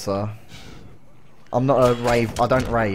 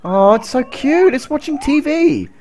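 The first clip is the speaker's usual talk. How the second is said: cooing; 12 semitones higher, 4 dB louder than usual. Real, not acted. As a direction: coo over something cute. Cooing at cute creature.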